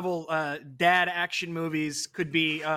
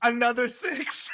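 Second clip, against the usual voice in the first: excited shout; 4 semitones higher, slower than usual. Real, not acted. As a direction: announce the lucky roll like a win. Rolling dice success.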